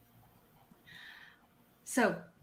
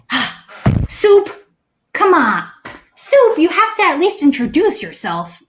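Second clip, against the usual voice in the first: much higher and louder, a gentle noble lady coaxing a shy friend; Lady Evelyn voice; 9 semitones higher, 5 dB louder than usual. Acted. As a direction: call him out like an elegant elf lady. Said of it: "Lady Evelyn says".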